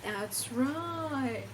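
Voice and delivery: ordinary delivery, nothing marked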